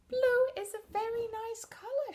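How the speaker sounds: high, sweet, gentle character voice; kind Yellow. Acted. Yellow comforting Blue in a high sweet voice. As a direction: lift way up and soothe. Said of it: "she'd say".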